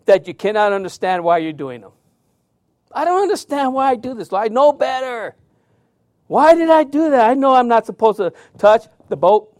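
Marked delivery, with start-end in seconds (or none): 2.9-9.5 higher, pleading self-questioning; inner voice of a sinner. Retold or imitated. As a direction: act out the frustrated believer asking himself why. Voicing inner struggle of sinner.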